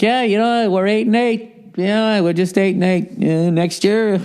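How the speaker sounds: high-pitched mock voice of an all-accepting coach; resigned coach. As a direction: shrug off the losses in a high put-on voice. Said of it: "you're coaching a team, it'd be like".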